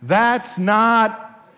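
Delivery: slow, loud, high drawn-out protest; yelled protest. Retold or imitated. Student yelling a slow, loud protest at the professor. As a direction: yell it from the back row. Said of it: "somebody in the back of the room yelled".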